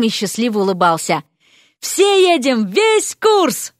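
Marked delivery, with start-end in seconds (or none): 1.8-3.7 high-pitched excited calling out; jubilant announcement. A narrator's reading. Student rallying the whole class, calling out jubilantly. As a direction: crow it to the whole room.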